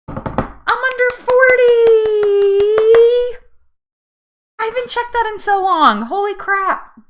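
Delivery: high, loud squeal of delight; excited surprise. Real, not acted. Seeing her BMI dip under forty, excited squeal. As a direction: burst out thrilled and amazed.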